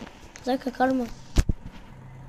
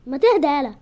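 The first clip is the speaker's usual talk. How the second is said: annoyed shout; 2 semitones higher, 8 dB louder than usual. Acted. Sibling quarrel, telling him calm.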